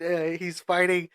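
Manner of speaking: ordinary talk, nothing marked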